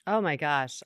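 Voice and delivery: ordinary talk, nothing marked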